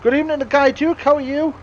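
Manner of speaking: loud, high and cheerful; excited greeting. Real, not acted. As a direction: greet him with a big lift.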